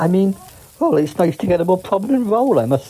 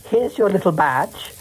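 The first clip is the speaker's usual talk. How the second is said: ordinary delivery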